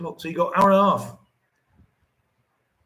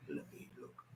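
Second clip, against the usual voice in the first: muttered aside; 14 semitones lower, 28 dB quieter than usual. Real, not acted. Muttering to self while checking.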